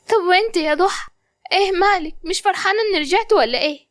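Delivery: put-on high girlish voice, just waking, a little confused; a young woman coming round in hospital; waking patient. Qamar waking in hospital asks what happened, dazed and high.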